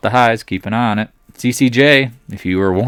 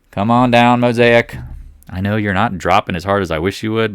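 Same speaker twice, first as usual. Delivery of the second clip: usual speaking voice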